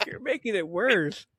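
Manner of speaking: high-pitched retold protest; mock exasperation. Grandchild begging pitying grandma to stop, mock exasperated.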